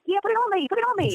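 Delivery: put-on high, excited little-girl Southern accent; imitating herself at four asking in a Southern accent; little kid Southern accent. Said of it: "in a really strong Southern accent".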